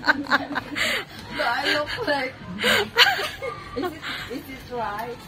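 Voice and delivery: very slow Ilonggo accent through laughter; laughing demo of Ilonggo-accented English. Shown as a demonstration. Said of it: "That is the accent of Ilonggo".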